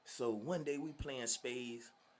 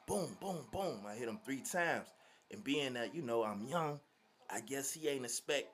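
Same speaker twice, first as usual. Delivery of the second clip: normal talking voice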